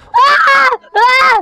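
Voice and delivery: high loud yelps of fear; frightened cries. Real, not acted. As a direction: yelp each time it hits. Monster attacking.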